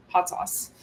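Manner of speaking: whispered Hot sauce; whispered, teasing Hot sauce; hushed announcement. Real, not acted. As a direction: tease the topic in a hush. Announcing rant topic.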